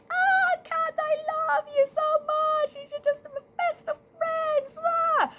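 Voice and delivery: very high, loud, syrupy squeal; hammed-up adoring best friend, squealed at the top of the voice; fake gushing. Rat to Cat's face, gushing falsely. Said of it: "But in front of Cat, it was like".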